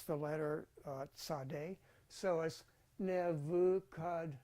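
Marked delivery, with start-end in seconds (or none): 2.1-4.3 Nebuchadnezzar said as Nevukadnetsar, with v and ts; Hebrew pronunciation. Shown as a demonstration. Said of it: "There's not a Z in Nebuchadnezzar".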